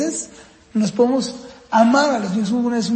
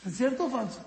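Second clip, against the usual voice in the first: ordinary talk, nothing marked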